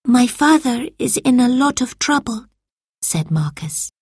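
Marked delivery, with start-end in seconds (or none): 0.1-2.5 put-on worried boy voice. A narrator's reading. Marcus worried.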